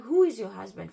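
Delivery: loud, sharp question at the caller; shouting back at the caller who says to leave her husband; loud demand. Retold or imitated. Confronting the caller on the phone.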